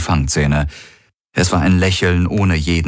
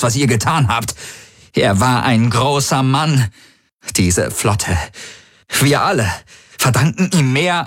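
angry growl that builds higher; growled accusation; 5 semitones higher than usual. A narrator's reading. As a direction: growl out grief-fuelled outrage, climbing in pitch. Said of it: "Hawks hörte Tari knurren".

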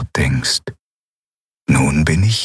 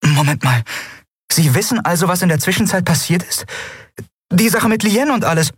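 high, puzzled questioning, rising as it goes; confused; 11 semitones higher than usual. A narrator's reading. Endriel questions Yunnan about what happened, high and confused. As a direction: stop short and ask in puzzlement. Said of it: "Dann hielt sie verwirrt inne".

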